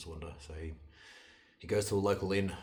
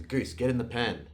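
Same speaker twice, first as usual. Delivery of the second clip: loud, higher, slow command; raised-voice summons to an animal; commanding the goose; 5 semitones higher, 8 dB louder than usual. A narrator's reading. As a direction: order the goose firmly, drawing it out. Old man commands the goose.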